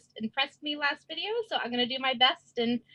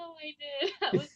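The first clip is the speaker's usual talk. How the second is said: laughing; 4 semitones higher, 4 dB quieter than usual. Real, not acted.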